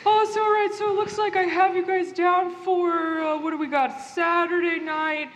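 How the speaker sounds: a breezy friendly ghost; chirpy ghost Terry. Acted.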